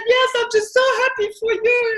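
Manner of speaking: high, loud, overcome with joy; high teary gush. Retold or imitated. Telling friends at the coffee shop she's happy, high and loud. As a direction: squeal it out through happy tears. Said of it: "I said".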